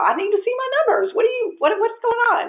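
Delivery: fast, high frantic craving for numbers; comic retold panic on the phone to husband; urgent demand.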